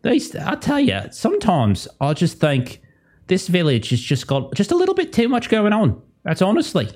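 high, folksy put-on character voices; chatting villagers. Game master voices villagers chatting about a fire, high casual mock voices. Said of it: "You just hear them like".

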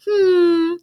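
high, loud put-upon "hmm" sigh; passive aggressive sigh. Retold or imitated. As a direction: sigh like a martyred mother-in-law. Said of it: "And then would go".

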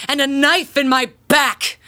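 mock-tragic complaint; overdramatic. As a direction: play the martyr grandly. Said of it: "being a little overdramatic".

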